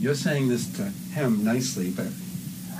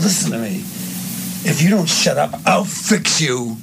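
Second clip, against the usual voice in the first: raised, slow final threat; really mean; 6 semitones higher, 3 dB louder than usual. Shown as a demonstration. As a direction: demonstrate a menacing warning, drawing out the end. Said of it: "now you're really mean".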